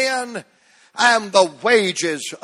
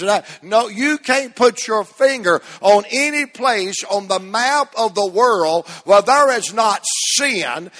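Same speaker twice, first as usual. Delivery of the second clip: normal talking voice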